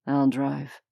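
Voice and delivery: low, even man's voice; deep male voice. A narrator's reading. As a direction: drop low for Leonidas. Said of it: "Leonidas said".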